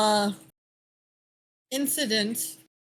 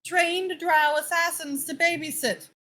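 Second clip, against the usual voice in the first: exasperated official; 5 semitones higher than usual. Acted. NPC exasperated about children.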